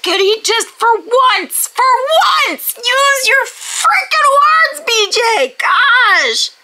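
high, drawn-out exasperated yell; angry outburst. Real, not acted. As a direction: build to a frustrated shout at the character. The amount of anger that I have.

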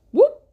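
high, loud cartoon whoop; high excited whoop. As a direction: pop the crown on with a yelp. Crown lands on Adam.

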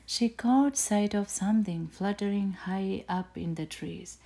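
plain reading voice

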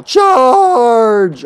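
loud, high battle cry. Acted. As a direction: yell it as you charge in. Rushing off into battle.

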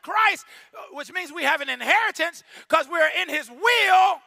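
loud, rising sermon shout; preaching. Acted. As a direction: preach it with full force to the church. Preaching at full force.

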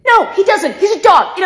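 exasperated shout. Acted. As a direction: yell it out, fed up. Yelling at sibling's dog voice.

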